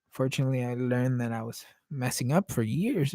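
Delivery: ordinary delivery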